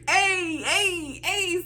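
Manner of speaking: high, laughing hey hey hey; cheering the thick envelope, high and laughing; giddy cheer. Retold or imitated. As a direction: celebrate with a bubbling laugh.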